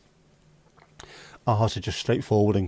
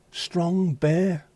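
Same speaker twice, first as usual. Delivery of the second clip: awed echo; 7 semitones higher than usual. Pretend. Mock awed echo, high.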